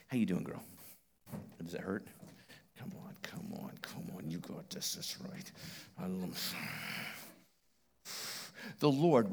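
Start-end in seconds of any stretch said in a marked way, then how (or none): 0.1-6.5 hushed, soft encouragement; soft whisper of encouragement; quiet aside. Real, not acted. Preacher softly coaxing a girl nearby, whispered.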